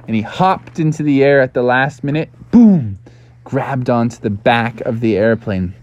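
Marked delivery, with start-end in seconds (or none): 2.5-3.0 sudden loud 'boom'; story sound effect. A narrator's reading. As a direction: punch out the impact noise.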